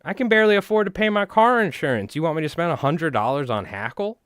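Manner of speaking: high, loud mock outrage; incredulous retort. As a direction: scoff at the idea of an eighty dollar hackle. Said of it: "And I'm like".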